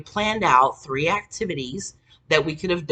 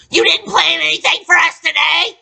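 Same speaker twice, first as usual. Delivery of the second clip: high, loud accusing yell; hammed-up boyfriend blowing up; mock angry shout; 13 semitones higher, 11 dB louder than usual. Boyfriend yelling that she planned nothing, mock shout.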